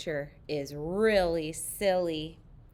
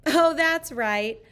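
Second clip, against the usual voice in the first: put-on higher, louder, slow kids' shout; a light mock shout from the kids; playful shout; 8 semitones higher, 5 dB louder, slower than usual. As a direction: shout it out as a happy class. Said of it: "we shout".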